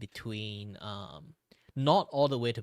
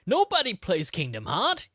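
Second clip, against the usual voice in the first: louder and higher, throwing up hands; exasperated; 9 semitones higher, 9 dB louder than usual. Real, not acted. Streamer telling chat nobody plays Kingdom Heart, playful exasperation. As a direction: vent playful frustration.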